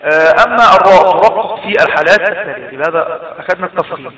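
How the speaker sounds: normal talking voice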